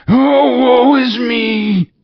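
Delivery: very high, loud, slow howl; doomed ghost's wail; wailing lament. Acted. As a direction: cry out in anguish.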